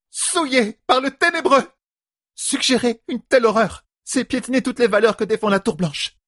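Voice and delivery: very high-pitched horrified denunciation; outraged. A narrator's reading. A sister denounces the horror before the hall, outraged. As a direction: cry out as if at blasphemy.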